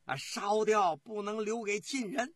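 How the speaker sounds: loud, high shouted command; urgent order. Acted. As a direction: shout a frantic imperial order. Emperor frantically orders the camp burned. (高声急令)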